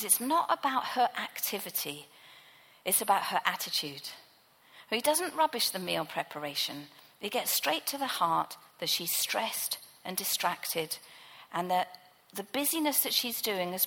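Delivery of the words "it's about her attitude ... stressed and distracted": ordinary delivery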